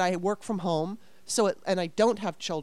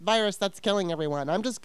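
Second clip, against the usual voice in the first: lightly whiny, put-upon mock voice; a mild sarcastic whine. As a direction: imitate an entitled complainer, only lightly. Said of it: "being so entitled and whining and going".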